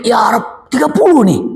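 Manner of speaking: high, startled exclamation; shocked. Retold or imitated. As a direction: gasp it out in disbelief. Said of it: "Dia kaget". (kaget berseru)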